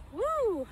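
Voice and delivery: high cheering woo; excited whoop. Real, not acted. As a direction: let out a happy whoop. Cheering during workout.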